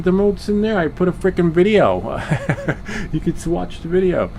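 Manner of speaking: fed up, laugh through it; exasperated retort. Retold or imitated.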